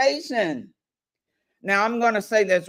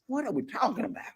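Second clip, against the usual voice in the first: mock baffled; 4 semitones higher, 9 dB quieter, faster than usual. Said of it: "I'm like".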